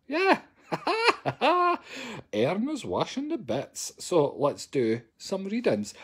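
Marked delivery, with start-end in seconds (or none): 0.1-1.8 squealing high laugh; real giggle at own joke. Real, not acted.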